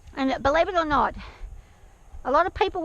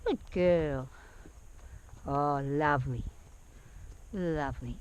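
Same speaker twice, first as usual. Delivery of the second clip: low, soft, drawn-out praise; 9 semitones lower, 7 dB quieter, slower than usual. Real, not acted. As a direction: purr a calm reward to the horse. Praising the horse as she walks.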